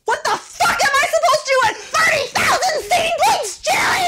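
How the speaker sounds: high laughing yell. Acted. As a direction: blow up at the couple, yelling. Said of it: "don't yell at me".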